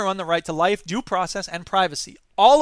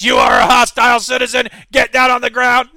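loud robotic command, much louder; hostile police robot; 4 semitones higher, 11 dB louder than usual. Acted. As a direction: bark orders like a malfunctioning robot cop. Police robot answers citizen.

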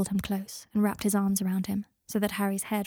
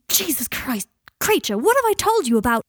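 jolted awake, much higher and louder; startled shout; 7 semitones higher, 8 dB louder than usual. Acted. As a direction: yelp in shock then scold. Harry startled awake by Kreacher.

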